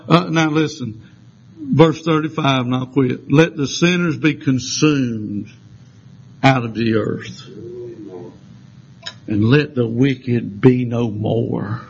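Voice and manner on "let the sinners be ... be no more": slow, low, drawn-out scripture reading; solemn scripture reading. Acted. Preacher reading the verse aloud to the church, slow and solemn. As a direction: weigh every word of the verse.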